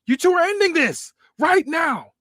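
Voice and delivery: high, loud, slow angry bellow; the boss losing his temper in a high shout; furious shout. Acted. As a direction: yell at them both to end it now. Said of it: "Paul yells".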